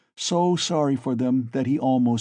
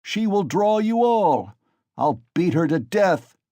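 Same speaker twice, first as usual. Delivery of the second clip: put-on drunken rage; 7 semitones higher, 5 dB louder than usual. A narrator's reading. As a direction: threaten the mare in fury. Mikolka raging at the mare.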